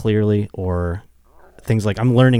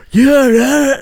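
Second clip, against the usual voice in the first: much higher, louder fed-up yell; mock impatient yell; 8 dB louder than usual. Host mimics yelling at his deaf parents, loud mock impatience. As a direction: bark it at parents who can't hear. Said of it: "And then I'll be like".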